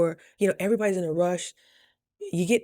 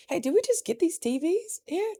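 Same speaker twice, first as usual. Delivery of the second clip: put-on high, sweet little-girl voice; sweet question; 12 semitones higher than usual. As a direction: ask it like his little princess. Sweet question to owner's son.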